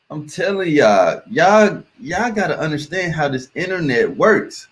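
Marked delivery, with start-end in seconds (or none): none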